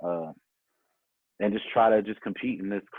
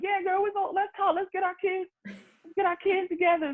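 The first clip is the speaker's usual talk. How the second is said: much higher-pitched, chummy mom voice; imitating mom. Mom gushing to another mom in the casting line, much higher, chummy voice. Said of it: "my mom was talking to her mom, like".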